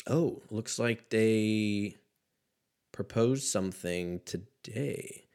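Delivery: normal talking voice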